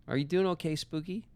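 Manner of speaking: cooing to a pet. Acted. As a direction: coo it like to a puppy.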